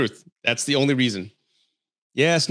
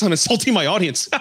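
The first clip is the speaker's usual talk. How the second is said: normal talking voice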